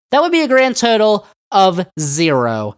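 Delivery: ordinary talk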